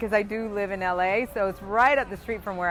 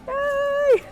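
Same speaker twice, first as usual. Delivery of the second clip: a high, loud little cheer; delighted whoop; excited cheer; 6 dB louder than usual. Real, not acted. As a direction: squeal a quick yay for the women. Cheering that the first star was a woman.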